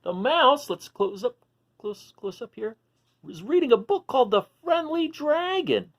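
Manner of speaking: lively, high storybook voice; animated read-aloud for little listeners; reading to children. A narrator's reading. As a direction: read it out like a picture book for kids. Reading picture book to children.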